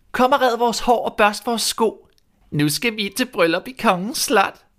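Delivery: put-on high, sneering spoiled girl; mean stepsister voice. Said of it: "sagde til Askepot". (høj, hånlig stemme som en ond stedsøster)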